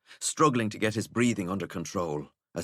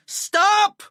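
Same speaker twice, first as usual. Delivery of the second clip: shrill high screech, much louder; furious high shriek from far behind; 10 dB louder than usual. Acted. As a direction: scream it after a fleeing boy. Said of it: "Filament screeched".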